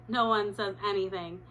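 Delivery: ordinary delivery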